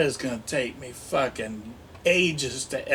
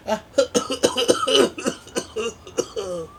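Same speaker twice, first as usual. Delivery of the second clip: hammed-up fake cough; 7 semitones higher than usual. Stoner doll voice, faking a big cough. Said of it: "that fake cough".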